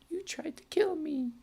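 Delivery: high, whiny mock voice; mock whiny complaint. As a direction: whine it like a sulky kid.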